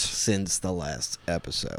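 very slow, low announcer delivery; deliberate show-opener voice; announcer intro. Pretend. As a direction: space out each word for the big reveal. Announcer-style intro to the segment.

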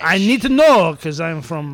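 put-on lightly French-accented reading; French character voice. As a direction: read it with a fake French accent.

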